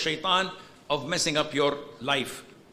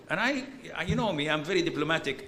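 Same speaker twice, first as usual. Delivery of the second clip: normal talking voice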